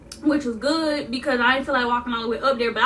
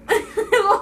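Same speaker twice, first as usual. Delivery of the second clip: short laugh; 8 semitones higher than usual. Real, not acted. Laughs at own story.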